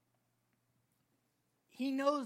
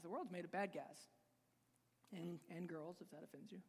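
much softer, fading aside; trailing off quietly; 5 semitones lower, 13 dB quieter than usual. Real, not acted. Preacher adding a quiet aside to the congregation, fading. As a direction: let it drop to near nothing.

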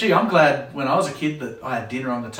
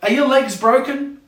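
higher, louder sarcastic retort; sarcastic mum; 10 semitones higher, 6 dB louder than usual. Acted. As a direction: throw it back with sarcasm. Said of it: "the mum responds with".

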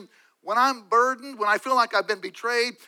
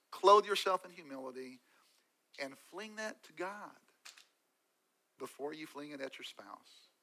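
much softer, almost under the breath; soft appeal; 3 semitones lower, 10 dB quieter than usual. Real, not acted. Preacher urges spouses to pray first, very soft. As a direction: ease down to an intimate plea.

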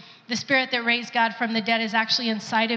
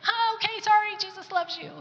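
much higher, sheepish backpedal; a flustered retreat after getting it wrong, pitched way up; awkward apology; 10 semitones higher than usual. Retold or imitated. Woman apologizing to a stranger she chased, sheepish and much higher. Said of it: "I said".

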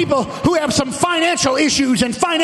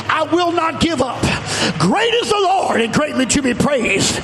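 full-force preaching; 4 semitones higher than usual. Real, not acted. Preacher defying the devil and vowing to praise, full-force preaching. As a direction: shout each declaration like a revival preacher.

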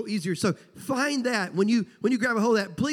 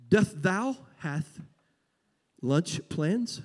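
mock King James English. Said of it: "if you heard somebody talking like that".

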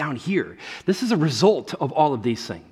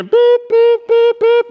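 high, loud electronic beeps; imitated beeping; 10 dB louder, slower than usual. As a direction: mimic a meter going off. Imitates a sin meter beeping.

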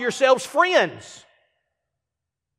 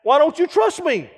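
higher, whiny hurt question; mock offended; 7 semitones higher than usual. Friend demands trust, mock offended and higher. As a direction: play the wounded friend. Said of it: "just throw that out".

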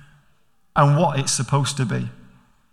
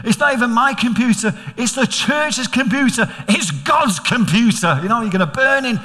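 mock scolding; 6 semitones higher than usual. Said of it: "I said".

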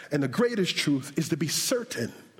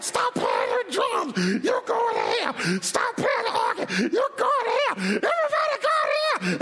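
frantic high screeched outbursts; mock meltdown, climbing ever higher; imitated screaming preacher. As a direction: scream at band and congregation alike. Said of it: "And so when he screamed".

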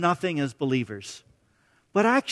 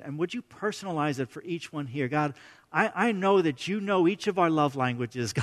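soft, earnest praying voice; hushed sincere prayer; 5 semitones lower, 7 dB quieter than usual. Real, not acted. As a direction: pray quietly for the congregation. Pastor leads a prayer.

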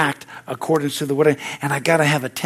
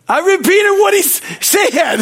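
high, loud protest; 6 dB louder than usual. Retold or imitated. A preacher defending himself to the crew, high and loud. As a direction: pitch jumps way up, loud and defensive.

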